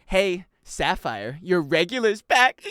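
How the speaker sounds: slow, drawn-out teasing call; mocking tease. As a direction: sing out the tease slowly. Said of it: "they make fun of her".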